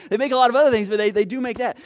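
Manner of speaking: very loud, driving it home over the laughter; booming preacher. Real, not acted. Preacher topping the laughter with a loud call. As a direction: belt it out to the back row.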